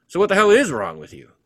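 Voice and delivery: high, loud exasperated demand; demanding shout. Acted. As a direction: yell the question at him. Alan confronts narrator in story.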